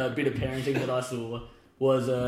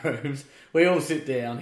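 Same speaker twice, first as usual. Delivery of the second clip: normal talking voice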